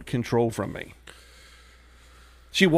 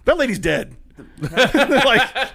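higher, louder, laughing it off; laughing protest; 9 semitones higher, 6 dB louder, much slower than usual. Real, not acted. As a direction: blurt it out while cracking up. Laughing defense.

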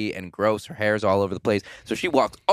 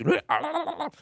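creepy hag cackle. Retold or imitated. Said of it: "you can kinda hear Mother in the background".

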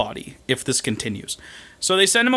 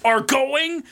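dramatic doom voice; 10 semitones higher, much slower than usual. Retold or imitated. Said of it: "this is where we get the".

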